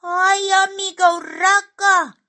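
very high, loud little voice; squeaky, pleading call; anxious exclamation. A narrator's reading. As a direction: cry out to a friend for help. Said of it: "exclamó Simón".

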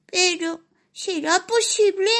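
high little mouse lamenting. Acted. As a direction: sigh out the complaint, squeaky. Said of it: "se lamentaba el roedor".

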